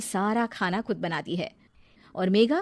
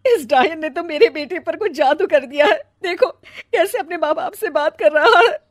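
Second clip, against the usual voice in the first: bitter lament; 8 semitones higher, 9 dB louder than usual. Acted. Mother laments son turned.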